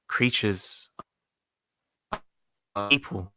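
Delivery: fading, breaking-up call audio; words dropping out over bad connection; barely audible. Real, not acted. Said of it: "Sorry, you're cutting out a bit".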